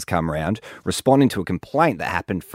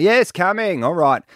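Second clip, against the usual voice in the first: high-pitched, exasperated call to the door; acted holler from another floor; 10 semitones higher, 3 dB louder than usual. Basement tenant answering the front door, calling up.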